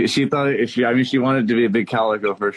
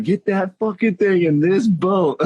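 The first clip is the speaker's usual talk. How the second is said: stern; 6 semitones higher, slower than usual. Retold or imitated. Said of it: "I'm like".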